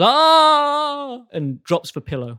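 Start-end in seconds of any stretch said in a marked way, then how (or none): 0.0-1.2 high, loud startled yell; loud drawn cry of fright; startled scream. Acted. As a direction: scream in alarm as the startled figure. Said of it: "The figure looks up and goes".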